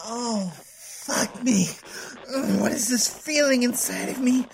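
normal talking voice

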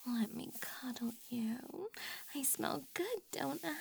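soft breathy whisper close in. Acted. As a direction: whisper it right by his ear.

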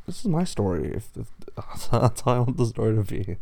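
usual speaking voice

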